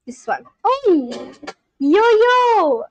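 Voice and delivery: ordinary delivery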